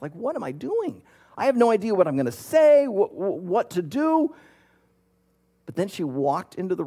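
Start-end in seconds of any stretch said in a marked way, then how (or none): 0.1-4.3 higher, mock-anxious rush of thoughts; nervous inner voice. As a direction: act out her jittery panic. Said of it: "she is just nervous".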